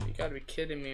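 usual speaking voice

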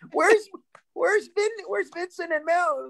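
high, anxious mother calling for her sons; mock panicked mother looking for her boys; worried mom. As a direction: pitch way up like a frantic mom. Mom searching for missing sons.

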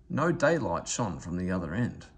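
ordinary delivery, nothing marked

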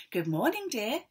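a wife cheerfully waking her husband. Acted. Missus Bear greets husband.

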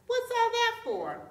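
high, loud startled question; tiger's loud, indignant query; alarmed demand. A narrator's reading. Tiger alarmed at spurs and whip.

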